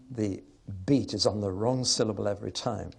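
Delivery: ordinary talk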